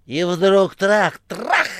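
high, loud, slow bangs; Korovyev's voice. A narrator's reading. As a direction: act out the gunfire. Korovyev voiced, mimicking shots. (Коровьев изображает выстрелы)

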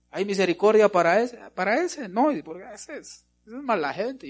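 high, loud, sneering churchgoer; mock judgmental voice. Preacher mimics a judgmental believer, high sneering mock voice. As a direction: play the snob who judges others.

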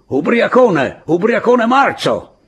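very high, loud yell; shouted abuse. A narrator's reading. Woman yelling at drunken Pablo in the trees, shouted abuse. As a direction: yell the insult, stretching the last words.

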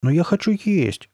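high, slow, stretched protest; indignant. A narrator's reading. Hungry girl protesting to her friend, indignant. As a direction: protest indignantly, voice pitched right up. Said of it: "возмутилась Карли Бет".

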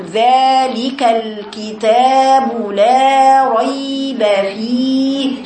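very slow recitation, every long vowel in "ذلك الكتاب لا ريب فيه" sustained; full-voiced madd. Shown as a demonstration. Teacher modelling the Sheikh's powerful madd, sustained. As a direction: hold "لا" and "فيه" long and strong. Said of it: "يقرأ كده".

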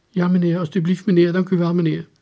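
high, eager thanks; meek, grateful suspect. Acted. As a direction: thank the officer meekly in a high voice. Meek suspect answers the warning.

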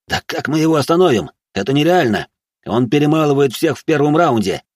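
high, exasperated protest; flustered shout, pitched well up; assistant yelling back. Acted. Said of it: "заорал и помощник".

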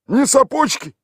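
high, put-on village voice; gruff local man answering a stranger; rough villager. A narrator's reading. Tolik answers the city stranger in a rough village voice.

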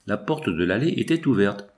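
ordinary delivery, nothing marked

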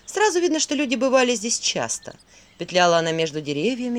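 ordinary delivery

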